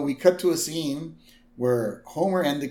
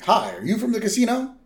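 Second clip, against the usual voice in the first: high, quick greeting; lightly acted traveler checking on a ride; 8 semitones higher, faster than usual. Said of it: "He's like".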